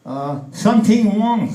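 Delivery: put-on high, loud, drawn-out Chinese accent; mock Chinese accent. Father answers wife with the punchline, mock Chinese accent.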